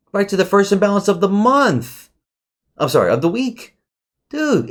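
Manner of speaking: high, thrilled rush of words; can't believe the chart did it; excited. Real, not acted. Excited about price hitting level.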